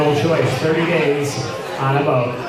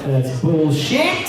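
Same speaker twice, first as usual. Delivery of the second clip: mock British accent; 3 semitones lower, much slower than usual. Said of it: "must talk in a British accent".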